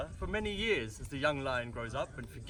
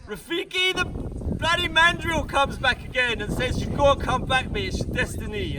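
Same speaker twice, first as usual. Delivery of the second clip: excited retelling; 10 semitones higher, 9 dB louder than usual. Acted. Rafiki retold excitedly.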